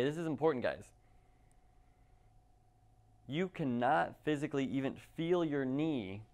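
ordinary talk

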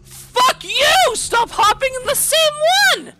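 high, loud frustrated cry; angry yell. Real, not acted. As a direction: yell at the game. Rage at game.

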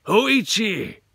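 loud, high eerie samurai call; samurai shout. Acted. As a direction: bellow the name. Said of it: "the samurai called".

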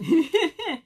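high, loud giggle; short burst of amused laughter. Real, not acted. Amused by the snail's cat.

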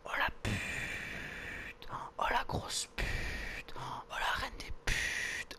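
slow, drawn-out whispered curse; appalled whisper. Real, not acted. Player reacts to a cruel remark on suicide, appalled whisper. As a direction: whisper each insult in long breaths.

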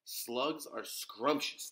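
usual speaking voice

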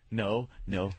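ordinary delivery

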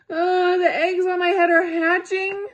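high, loud panicky wail; panicked elephant. A narrator's reading. Elephant wailing about eggs hatching on his head. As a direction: wail it like the sky is falling.

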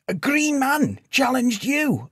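high, drawn-out disbelief; incredulous. A narrator's reading. Sage questions the knight's claim, incredulous. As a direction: pitch it way up, slow and astonished. Villager astonished by knight's confession.

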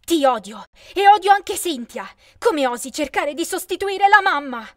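furious loud yell at her father; teenage furious shout. A narrator's reading. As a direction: scream it out in rage.

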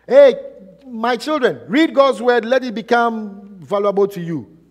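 put-on high, loud call to the children; acted parental urging; exhorting parent. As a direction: urge your kids like a preaching parent. Voices parent urging children to read.

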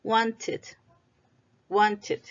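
"Wanted" as two clear syllables, "wan-tid"; -ed as extra syllable. Shown as a demonstration. Teacher models past tense for repetition, syllabic -ed. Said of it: "La E se pronuncia como I".

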